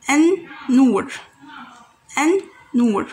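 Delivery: An-Nur, modelled for learners; Arabic word demo. Shown as a demonstration. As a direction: say An-Nur, holding the nn. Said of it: "which means the light".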